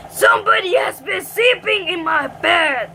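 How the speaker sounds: loud, growly Father Bear roar; big growly bear. A narrator's reading. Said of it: "great big growly voice".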